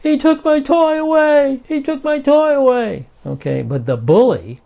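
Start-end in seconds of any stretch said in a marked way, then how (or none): 0.0-3.1 put-on high, wailing child's cry; grief-stricken little kid. Victim child crying out to the playground bully, high wailing. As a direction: cry out like a small kid robbed of his toy. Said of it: "the victim, in genuine grief, cries out".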